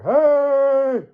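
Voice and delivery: high, loud yelled hey; mock shout. Mocking a coworker's shout, loud and high. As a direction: bark it out across the site. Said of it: "he's just up there".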